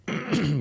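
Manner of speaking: quick throat clear. Real, not acted. Clears throat between sentences.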